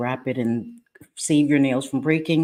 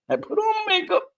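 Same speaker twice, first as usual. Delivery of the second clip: much higher, with a giggle; squeaky laughing repeat. Real, not acted. Proudly repeating to her viewers that she wore makeup, in a high laughing squeak. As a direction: say it again in a tiny giggly squeak.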